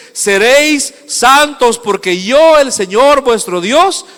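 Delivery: loud, high, drawn-out scripture proclamation; preaching at full force. Acted. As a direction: proclaim the verse slowly and forcefully.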